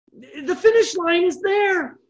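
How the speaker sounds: high, slow, stretched-out discovery; excited realization. Retold or imitated. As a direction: lift it high as the finish comes into sight. Runner realizes the finish is near.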